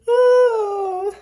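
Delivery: high whimpering cry; a real little wail of grief; breaking into a whimper; crying sigh. Real, not acted. Upset over the chapter.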